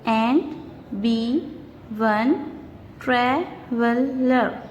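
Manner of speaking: slow, beat by beat with pauses; syllable by syllable. Shown as a demonstration. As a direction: stretch "traveler" into its syllables. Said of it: "Let's see the third one".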